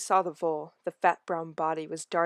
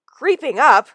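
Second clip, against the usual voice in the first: high loud offended repeat; young cat outraged at the insult; indignant echo; 8 semitones higher, 13 dB louder, slower than usual. Acted. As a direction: throw his words back, offended. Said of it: "eyes were round with indignation".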